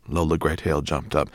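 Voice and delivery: usual speaking voice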